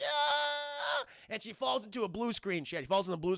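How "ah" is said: high, drawn-out scream; mock falling scream. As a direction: scream as if plummeting. Imitates falling woman's scream.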